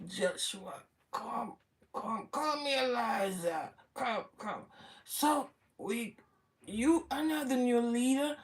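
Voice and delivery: a faint hint of a dying old man calling his successor near; dying Moses gently summoning Joshua, soft and frail; feeble dying Moses. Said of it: "Moses must have been something like this now".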